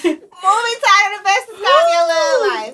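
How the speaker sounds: mock dramatic reread. Rereads question in a mocking voice.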